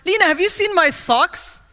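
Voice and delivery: mother's voice, pitched high; a lightly voiced mother; mom voice. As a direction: play the puzzled mom asking about her socks. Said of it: "And she's like".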